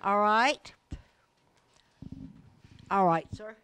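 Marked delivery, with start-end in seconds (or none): none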